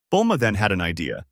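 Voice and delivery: ordinary delivery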